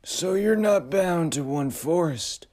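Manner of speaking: high, slow uncanny voice; cool otherworldly figure; eerie high voice. Acted. Frederick speaks.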